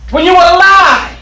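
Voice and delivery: whooping preacher at full volume; preaching at full force. Acted. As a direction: preach it with rising fire.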